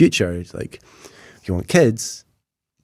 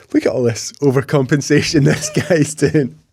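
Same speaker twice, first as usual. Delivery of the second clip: lightly mocking therapist, higher, with a laugh; amused outside commentary, pitched up; therapist's observation; 6 semitones higher, 4 dB louder than usual. Imagined therapist judging his jokes, lightly mocking and laughing. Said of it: "if you brought a therapist in".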